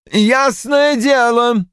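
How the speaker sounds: slow scornful cry; shouted. A narrator's reading. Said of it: "вскричал Рой".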